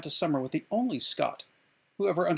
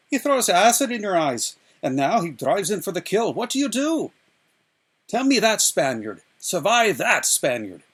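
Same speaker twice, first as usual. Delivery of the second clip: higher, louder, hammering master's challenge; MacPherson drilling; 5 semitones higher, 8 dB louder than usual. A narrator's reading. MacPherson challenging Inigo, higher and louder. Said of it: "MacPherson once said".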